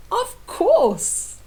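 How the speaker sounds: high, cheerful knight answering; grinning. Acted. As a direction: say it with a broad grin. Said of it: "he grinned".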